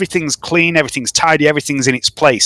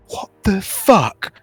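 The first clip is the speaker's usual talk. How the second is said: baffled; 4 semitones higher, 4 dB quieter, much slower than usual. Acted. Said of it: "eyebrows are raised and he's pointing at Gladstock going".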